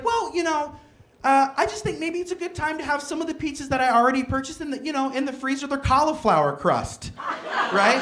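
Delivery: high, coaxing sweet wife voice; sugary wife voice. Retold or imitated. Wife pushing cauliflower pizza, high coaxing voice. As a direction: coax him sweetly toward the freezer pizza. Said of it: "she's like".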